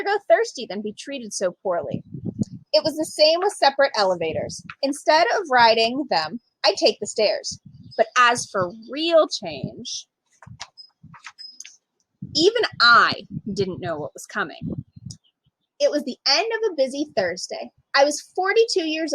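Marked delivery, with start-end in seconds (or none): none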